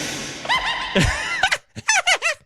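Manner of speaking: high, breathless laughing fit; helpless laughter. Real, not acted. Host replays Crypt Keeper clip, helpless laughter. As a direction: cackle helplessly in a high pitch. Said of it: "It's hilarious".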